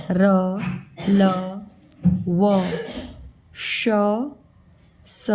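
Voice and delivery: teacher slowly reciting letters one by one; drawn-out letter names for children to copy; alphabet recitation. Acted. Teacher reciting letters aloud for children to repeat.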